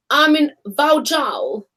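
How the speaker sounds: slow, "Vauxhall" read as vow-JAWL; sister's literal pronunciation. Retold or imitated. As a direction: sound out "Vauxhall" wrongly as vow-jall. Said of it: "'cause in Albania".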